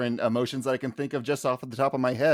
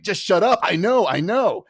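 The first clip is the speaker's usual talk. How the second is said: raised, higher-pitched exasperated yell; mock yell; 6 semitones higher, 3 dB louder than usual. Imitating a man yelling at a warning like a smoke alarm, mock yell. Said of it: "where he yells at the warning".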